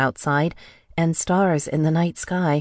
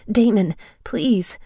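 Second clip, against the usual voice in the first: higher, slow begging; slow desperate plea; 6 semitones higher, slower than usual. A narrator's reading. Woman carried off, pleading with her captor. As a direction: beg him to stop.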